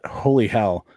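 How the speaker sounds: ordinary talk, nothing marked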